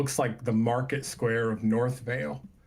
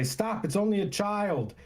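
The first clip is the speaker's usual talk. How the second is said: pleading voice; 9 semitones higher than usual. Acted. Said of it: "you hear someone say".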